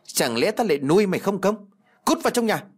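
high, scolding shout of a furious father; angry father. Acted. Father scolds his daughter for loving a poor boy, furious. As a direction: bark her back into the house.

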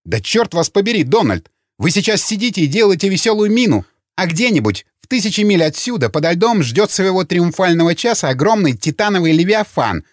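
high-pitched, agitated tirade; heated rant. A narrator's reading. Opponent berates Donald over the lurking nuclear submarine, angry and agitated. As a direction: let the alarm and anger drive the pitch up.

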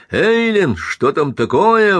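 high, loud imperious yell; commanding shout. A narrator's reading. Husband shouts at wife through locked door, commanding. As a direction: demand an answer through the door. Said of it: "властно закричал он".

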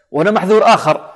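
ordinary talk, nothing marked

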